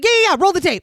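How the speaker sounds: high, loud eager brush-off; eager host. Retold or imitated. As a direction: rush it out like a greedy host. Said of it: "He's like".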